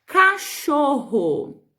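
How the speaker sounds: slow, syllable-stretched dictation of cachorro; ditado pausado. Shown as a demonstration. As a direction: dictate it very slowly to young pupils. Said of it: "A segunda palavra é". (ditado bem lento, sílaba por sílaba)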